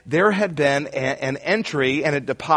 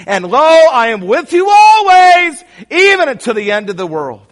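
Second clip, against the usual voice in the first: preaching shout; 9 semitones higher, 10 dB louder than usual. Real, not acted. Preacher shouting the verse to the congregation, full force. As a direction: cry the promise out over the room.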